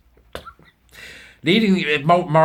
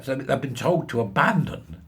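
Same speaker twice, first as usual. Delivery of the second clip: ordinary delivery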